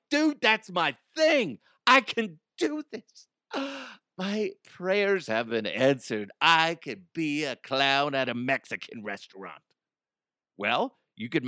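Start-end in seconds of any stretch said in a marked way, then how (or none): 0.1-9.6 excited job seeker. Pretend. Listener reading the clown job ad, mock overjoyed. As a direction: gush like a dream job came true. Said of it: "you're like".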